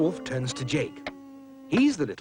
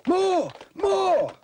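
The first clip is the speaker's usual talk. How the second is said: high, loud calls for more; audience shouting; 3 dB louder than usual. Acted. Audience calling for an encore, high and loud. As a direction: cheer for an encore. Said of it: "The audience shouts".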